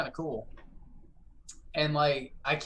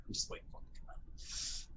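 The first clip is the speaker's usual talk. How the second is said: whispered, much softer and hushed; whispering; 13 dB quieter than usual. Real, not acted. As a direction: whisper so the enemy won't hear. Tense waiting, hushed.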